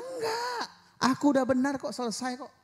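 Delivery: defiant retort. Acted. Believer answering Satan's accusation, defiant and high. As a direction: shut the accuser down, bold and high.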